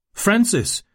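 high-pitched call of a name. A narrator's reading. Kate calls to Francis in the yard, calling out. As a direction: call after someone running off. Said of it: "rief sie".

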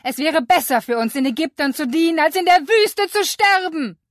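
very high, loud, bitter shouting; angry Israelites. Acted. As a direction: shout back in angry despair. People shouting at Moses.

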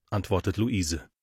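usual speaking voice

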